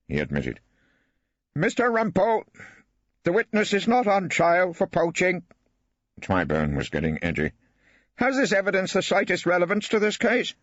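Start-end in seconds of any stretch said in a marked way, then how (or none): 1.5-10.5 high, testy judge voice; a high-pitched judge losing patience with counsel; edgy judge. Acted.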